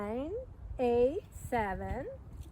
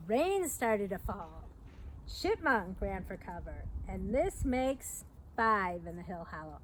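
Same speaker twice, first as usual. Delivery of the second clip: slow, lively picture-book storytelling; reading to children; 4 semitones lower, slower than usual. A narrator's reading. Reading The Big Storm aloud to young listeners, lively storyteller. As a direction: read it like story time for little kids.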